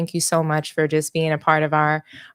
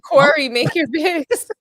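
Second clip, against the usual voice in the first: laughing impression; 9 semitones higher, 4 dB louder than usual.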